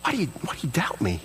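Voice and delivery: gently ask, a little wounded; kind, fatherly. Acted. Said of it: "do you see the difference?".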